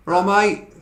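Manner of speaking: hearty go at a Cockney greeting; Cockney accent. Shown as a demonstration. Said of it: "show us your Cockney accent".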